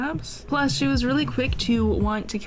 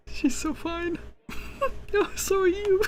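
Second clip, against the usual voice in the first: flirty high voice; 10 semitones higher than usual. Real, not acted. Flirting at game character.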